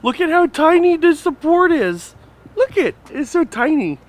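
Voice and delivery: high-pitched amazement; amazed. Real, not acted. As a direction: squeak out how tiny it is. Marvelling at tiny support.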